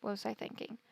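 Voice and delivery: very soft self-talk; muttering to self. Real, not acted. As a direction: mutter it to yourself. Muttering to herself.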